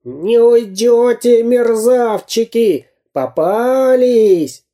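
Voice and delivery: high, long-drawn threatening yells; gloating cartoon bandit's cry; villain shouting. A narrator's reading. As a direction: holler after the fleeing pair. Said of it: "бежал Дырка и кричал".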